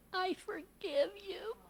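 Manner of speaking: put-on gently forgive, like a little girl; little girl forgiving her mother, soft and tender; tender child. Little daughter's tender reply.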